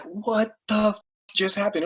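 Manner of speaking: high amazed exclamation, retold; stunned disbelief. Therapist repeating his stunned reaction to her recovery, high and amazed. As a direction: pitch up in shocked wonder.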